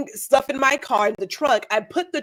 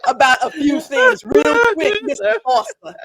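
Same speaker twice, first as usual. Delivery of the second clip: louder, laughing mock scolding; mock stern warning; 3 semitones higher, 6 dB louder than usual. Real, not acted. Co-host playfully warning the host, mock stern. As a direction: pretend to lay down the law.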